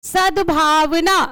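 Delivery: सद्भावना read slowly, syllable by syllable; slow careful reading. Shown as a demonstration. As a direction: spell out सद्भावना slowly for pupils. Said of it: "ऐसे शब्दों को कैसे पढ़ना है". (सद्भावना धीरे-धीरे, एक-एक अक्षर)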